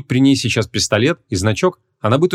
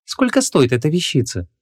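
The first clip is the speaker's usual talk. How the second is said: higher-pitched, doubtful woman; 6 semitones higher than usual. A narrator's reading. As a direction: ask the price with real doubt. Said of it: "с сильным сомнением спросила Ванесса".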